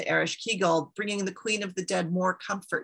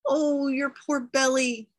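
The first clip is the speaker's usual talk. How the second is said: high, drawn-out cooing sympathy; crying in sympathy; 6 semitones higher, slower than usual. A narrator's reading. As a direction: fuss over someone's pain, slowly and high. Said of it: "they cried".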